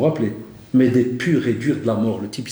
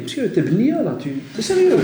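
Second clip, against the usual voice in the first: imitated student; 8 semitones higher than usual. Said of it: "qui reviennent à la récré".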